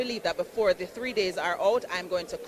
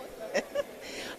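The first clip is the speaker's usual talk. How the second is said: high breathy laugh; 9 dB quieter than usual. Real, not acted. Host laughs after thanks.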